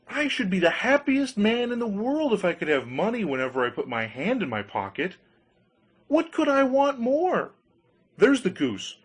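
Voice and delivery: giddy with joy; overjoyed Hans. Acted.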